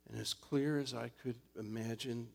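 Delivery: ordinary talk, nothing marked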